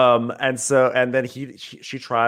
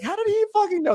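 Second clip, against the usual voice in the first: very high incredulous squeal; mock disbelief. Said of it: "I was like".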